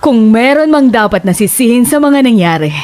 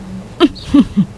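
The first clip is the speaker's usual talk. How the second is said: soft sly chuckle; 5 semitones higher, 4 dB quieter than usual. Acted. Landlady leaves with a secret.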